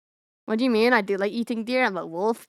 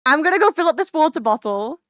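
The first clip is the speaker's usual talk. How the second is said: louder, higher announcement; insistent repeat; 7 semitones higher, 6 dB louder than usual. Real, not acted. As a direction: talk firmly over the interruption. Repeats it over an interruption.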